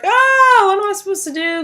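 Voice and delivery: put-on high, panicky kid's cry for help; high-pitched mock panic of a child who just had an accident; panicked child. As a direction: mimic a frightened little boy in a panic. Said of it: "he's freaking out 'cause he's like".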